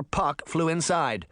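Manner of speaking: plain reading voice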